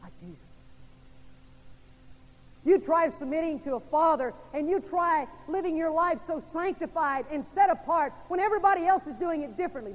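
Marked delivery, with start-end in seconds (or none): 2.7-9.9 high, loud, speeding up at the end; rally the congregation; preaching at full force. Acted. Preacher climax on submission.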